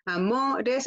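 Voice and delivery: "amores" broken after a drawn-out MO; stress on MO. Shown as a demonstration. Teacher gives a second rhyme example, stressing MO in "amores". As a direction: hold the MO of "amores", then add "res". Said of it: "es lo mismo".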